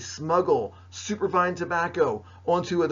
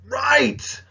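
excited exclamation; 8 semitones higher, 9 dB louder than usual. Real, not acted. Teacher confirms the answer.

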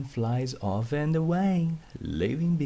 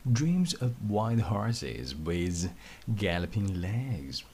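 ordinary delivery, nothing marked

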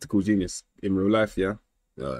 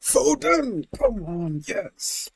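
excited cheer; much slower than usual. Real, not acted. Cheering player in football game.

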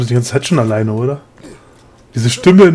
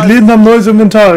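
excited announcement; 7 semitones higher, 6 dB louder than usual. Real, not acted. Excited about new shop stock.

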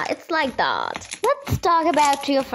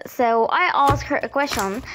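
usual speaking voice

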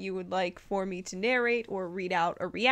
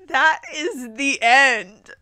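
slow, loud, laughing through it; disbelieving laugh; 3 semitones higher, 9 dB louder, slower than usual. Real, not acted. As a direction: break up laughing at the ending. Reader laughs at abrupt ending.

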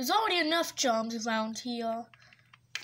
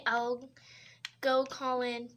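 usual speaking voice

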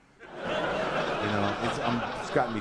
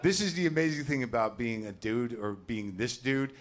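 normal talking voice

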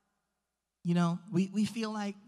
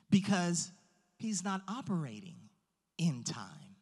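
ordinary talk

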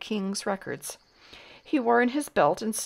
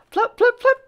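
squeaky high flop, flop; high flop sound; 11 dB louder than usual. A narrator's reading. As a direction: pop it out high and bright. Hats flying off, sound effect.

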